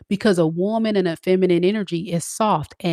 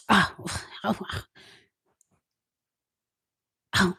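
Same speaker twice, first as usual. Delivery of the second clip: swooning sigh; 8 dB quieter than usual. Retold or imitated. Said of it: "y'all be like".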